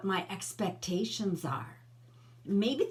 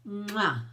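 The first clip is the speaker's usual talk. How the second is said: a light smacking kiss to viewers; playful air kiss sign-off; blown kiss. Real, not acted. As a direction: send them a kiss. Blowing a kiss goodbye.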